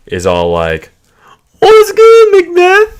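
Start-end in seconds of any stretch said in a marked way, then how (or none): 1.6-3.0 very high, loud ghostly falsetto; haunt him with a shrill ghost greeting; mock ghost calling out; spooky ghost. Ghost greets Macbeth, acted.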